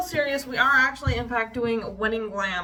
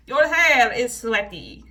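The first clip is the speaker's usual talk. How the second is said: loud, drawn-out mock Russian accent; 8 dB louder, slower than usual. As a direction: scold like a mean Russian hairdresser with the accent. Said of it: "doing that accent throughout this little".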